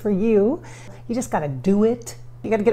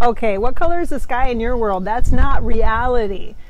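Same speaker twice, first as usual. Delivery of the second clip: mock scolding; 12 dB louder than usual. Mocking rebuke to viewer.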